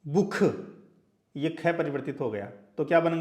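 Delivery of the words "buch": बुख with a rasping German ch at the end; teacher demonstrating the German word बुख; German pronunciation. Shown as a demonstration. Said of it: "इसको German में कर दिया".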